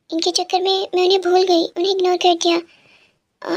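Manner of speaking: usual speaking voice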